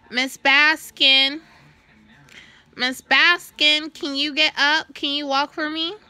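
mock shouting nurse, stretching the name. Mocking the old nurse calling her awake, loud and high. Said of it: "I'm gonna call her Medusa".

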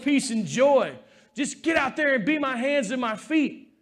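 preaching. Acted. Pastor calling the church to serve each other, full preaching force. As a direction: lift the room with each question.

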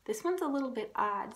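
ordinary delivery, nothing marked

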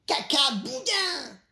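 very high, piping defiance; cheeky little rabbit. A narrator's reading. Little rabbit answering a hungry wolf, cheeky squeal. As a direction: squeal it high, holding the last syllable.

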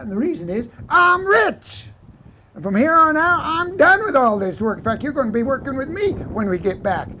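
boastful announcement. Acted. Vaquero announcing his new riches to the trail boss, boastful. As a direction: crow it out, stretching the big words.

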